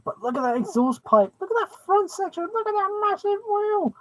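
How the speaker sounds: high, gushing excitement; excited. Real, not acted. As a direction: gush over the model car. Gushing over the Lego car.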